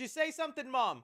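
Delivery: put-on kid calling to Mom. Shown as a demonstration. Son answering Mom in a mock dubbed movie scene, hammy calling out. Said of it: "what I'm about to do right now".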